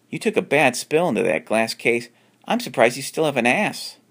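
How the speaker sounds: teasing. Acted. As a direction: rib him good-naturedly. John jokes to Alan.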